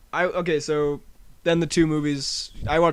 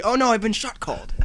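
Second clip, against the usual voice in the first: mock movie line; 7 semitones higher than usual. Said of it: "He says in the movie".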